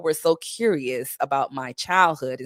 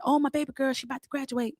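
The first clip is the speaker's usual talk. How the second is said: high, softer doting-father voice; imitating proud dad; 8 semitones higher, 4 dB quieter, faster than usual. Dad telling bus passengers about his daughter, imitated. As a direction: gush like a daddy about his girl. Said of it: "he was like".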